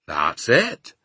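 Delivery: a touch of giant's pride; proud. A narrator's reading. Giant presents his dream to Sophie, mildly proud. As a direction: confirm it with mild pride. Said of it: "the B F G said proudly".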